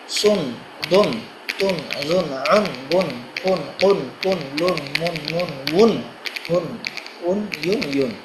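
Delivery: evenly spaced, level-toned syllables; syllable drill recitation. Shown as a demonstration. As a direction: recite the syllables to a steady beat. (সমান তালে উচ্চারণ মশক)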